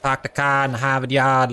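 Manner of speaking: Boston accent, dropped r's: "Pahk the cah in Hahvahd yahd". Shown as a demonstration. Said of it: "like my accent".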